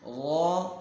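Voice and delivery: غا as held, rounded "zhaw"; thick vowel. Shown as a demonstration. Teacher models heavy letter, غا thick and held. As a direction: stretch غا as a heavy "zaw". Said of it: "غا موٹا پڑھنا ہے".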